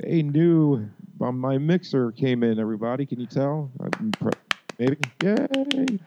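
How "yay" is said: high, bright yay of delight; excited cheer. Real, not acted. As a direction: cheer it out happily. Mixer finally arrived, cheering.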